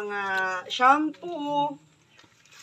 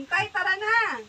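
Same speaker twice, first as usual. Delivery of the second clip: calling out; 8 semitones higher, 4 dB louder than usual. Real, not acted. Calling Nestor out of hiding.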